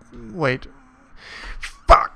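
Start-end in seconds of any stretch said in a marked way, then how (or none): 1.6-2.2 sharp loud curse at a mistake; frustrated curse. Real, not acted. Programmer swearing at his code, sharp loud curse. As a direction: spit it out at the screen. Coding error, swearing at screen.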